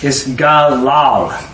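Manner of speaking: drawn-out galal, each syllable slowly; slow spelled-out Hebrew word. Shown as a demonstration. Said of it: "Strong's number fifteen fifty-six".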